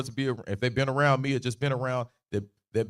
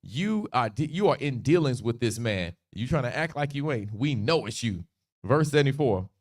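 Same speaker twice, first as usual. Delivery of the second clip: ordinary talk, nothing marked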